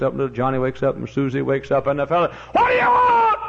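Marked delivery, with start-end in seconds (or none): 2.5-3.4 very high, loud furious question; roared at the pest outside; raging woken neighbour; angry shout. Acted. Neighbour woken third time.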